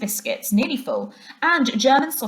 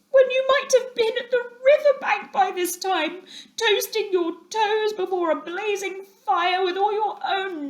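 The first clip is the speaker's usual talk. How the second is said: dismal lament; 12 semitones higher than usual. A narrator's reading. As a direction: whimper out his regret in a high voice. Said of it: "he cried dismally".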